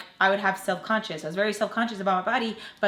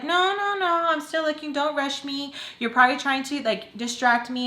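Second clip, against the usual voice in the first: mimicked brush-off; 6 semitones higher than usual. Woman refusing to be rushed, high mimicked brush-off. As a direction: wave it away in a high mocking voice. Retold brush-off through the door.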